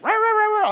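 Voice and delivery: high-pitched rapid dachshund yapping; frantic dog barking. Retold or imitated. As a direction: imitate little dogs going off. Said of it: "They're going and barking".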